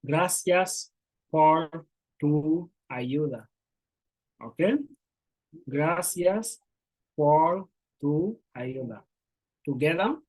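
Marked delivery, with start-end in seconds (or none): 0.1-3.5 slow, careful "Gracias por tu ayuda"; slow teacher model sentence; read slowly. Shown as a demonstration. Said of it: "I'll try to read it slowly". 5.7-9.0 slow, careful "Gracias por tu ayuda"; slow teacher model sentence; read slowly. Shown as a demonstration. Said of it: "I'll try to read it slowly".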